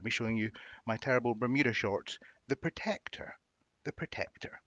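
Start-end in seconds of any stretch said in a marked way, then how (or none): 2.5-4.6 high, breathy stage whisper; soft and spooky, for show; mock-dramatic hush. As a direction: reveal the name in a hushed, theatrical whisper. Reveals the shirt card's name theatrically.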